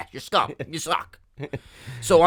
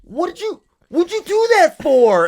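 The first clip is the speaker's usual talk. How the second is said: much higher and louder mock outrage; indignant stranger; 13 semitones higher, 6 dB louder than usual. Angry table players confronting him, high shrill mock outrage. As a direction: yelp it like they caught you cheating. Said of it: "they went".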